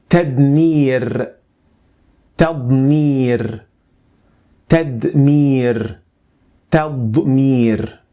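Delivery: "tadmir" with emphatic ḍād, slow and clear; slow clear dictionary pronunciation. Shown as a demonstration. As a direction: say "tadmir" as Arabic taḍmīr, deliberate. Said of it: "practice to get it right".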